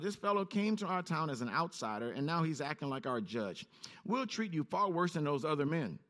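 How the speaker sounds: ordinary delivery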